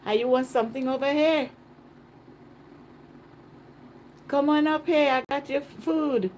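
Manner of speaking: high, punchy street greeting; islander impression. Islander offering food to a visitor, imitated calling out. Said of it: "They speak like this".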